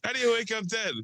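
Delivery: high-pitched mock movie quote; Steve Buscemi. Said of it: "it's a Steve Buscemi, uh, line".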